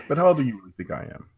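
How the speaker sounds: ordinary delivery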